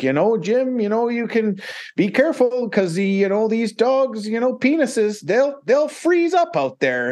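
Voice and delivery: high-pitched earnest musher voice warning Jim; squeaky, wide-eyed warning about the dogs; imitating the musher. Musher warns Jim about frozen dogs, high mock voice. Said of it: "he's like".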